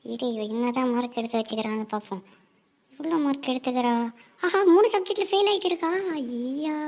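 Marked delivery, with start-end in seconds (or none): 4.4-5.9 gleeful gotcha. Acted. Gleeful discovery of failing marks.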